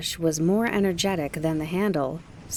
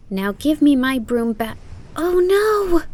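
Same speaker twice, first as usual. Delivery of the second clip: rising into a loud wail of dismay; 9 semitones higher, 6 dB louder than usual. A narrator's reading. Kiki wails at the boy over her broken broom. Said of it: "Kiki wailed when she saw her broom".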